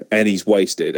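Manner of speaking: normal talking voice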